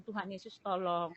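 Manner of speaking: soft, faint plea to God; barely above a murmur, begging for help; weak pleading prayer. Retold or imitated. Sick mother softly begging Jesus for help, faint and weak. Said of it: "Saya cuma bilang".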